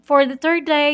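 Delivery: usual speaking voice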